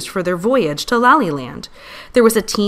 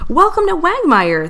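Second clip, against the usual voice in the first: higher, louder joyful welcome; excited call; 10 semitones higher, 5 dB louder than usual. Acted. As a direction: call it out warmly across the lawn. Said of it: "the king and queen called".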